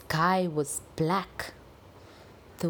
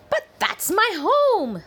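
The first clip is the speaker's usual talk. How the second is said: put-on high, loud protesting old lady; upset Gran; 13 semitones higher, 7 dB louder than usual. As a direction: cry out in alarm at the intruder.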